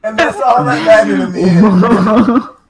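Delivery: slow, loud words through laughter; laughing. Real, not acted. Ending own story about the wings, loud and laughing. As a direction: crack up while landing the punchline.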